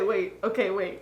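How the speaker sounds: ordinary talk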